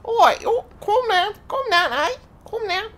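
high-pitched, hammed-up Scouser impersonation; mock Scouse accent. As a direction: tease with a squeaky put-on Liverpool accent. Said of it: "bit of Scouser in your, uh, in your accent".

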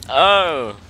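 loud sudden exclamation; startled. Real, not acted. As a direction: blurt it out at the crash. Startled by falling bananas.

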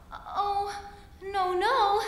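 high, loud little fly voice; buzzy housefly. Acted. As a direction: squeak it high and urgent. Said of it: "said the little fly".

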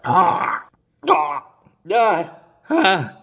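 strained high gasps at the sourness; pained gasps. Real, not acted. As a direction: wince through short sharp ahs. First sip of very sour beer.

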